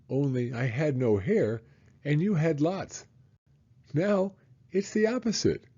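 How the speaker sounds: a hint of a small boy talking to his dad; lightly voiced little boy. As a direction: read it as the excited little boy. Child voice reading son's lines.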